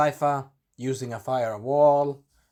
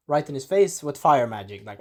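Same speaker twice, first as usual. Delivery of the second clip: ordinary delivery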